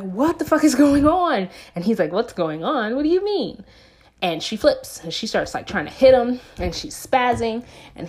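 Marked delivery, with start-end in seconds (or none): 0.0-1.5 loud, high exasperated outburst; retold shout of disbelief and outrage; outraged demand. Acted confrontation with her captor. 2.1-3.6 mock-clueless, pitch leaping up at the end; imitating an innocent act; feigned innocence. Captor feigns ignorance when confronted.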